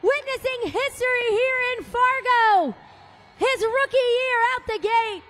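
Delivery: big, high, loud stadium announcer voice; arena announcer. Acted. Announcer crowning the overall champion to the Fargo crowd, booming. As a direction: sell the champion reveal to the whole arena.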